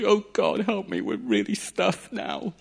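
high, hammed-up plea to God; mock desperate cry. Moses crying out to God at the Red Sea, mock panic. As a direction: cry out like a cornered man. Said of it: "he's crying out behind this bush".

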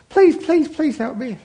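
high, pleading little creature voice; pleading snake voice. Acted. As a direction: beg like a small cold snake. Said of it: "she said".

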